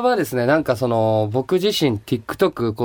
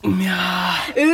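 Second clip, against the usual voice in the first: long drawn-out, higher cry of delight; real savoring delight; 5 semitones higher, much slower than usual. Real, not acted. Guest relishing the first sip of shochu, drawn-out delight. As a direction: stretch it out, relishing the drink.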